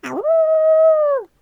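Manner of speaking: high, loud howling wolf cub; baby wolf howl. Shown as a demonstration. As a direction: throw your head back and howl high. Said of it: "let's do a little baby wolf howl".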